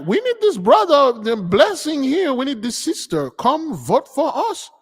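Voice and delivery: high, coaxing caller voice; high mocking plea to come vote; mock caller pleading. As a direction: sweet-talk them into voting, high pitched. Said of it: "They're like".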